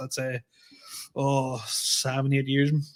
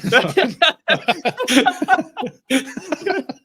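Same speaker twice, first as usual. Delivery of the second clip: high laugh; 12 semitones higher, 3 dB louder than usual. Real, not acted. Laughs hard.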